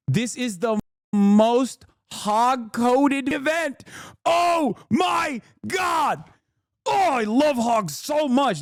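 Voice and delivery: high, slow, stretched-out squeal; mock gleeful excitement. Streamer gushing over a hog-coded event, hammed-up glee. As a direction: gush over it like a delighted fanboy.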